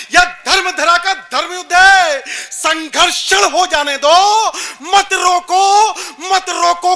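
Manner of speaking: rally the crowd with ringing verse; full-force poetry recitation. Acted. (बुलंद ललकार में वीर रस काव्य पाठ)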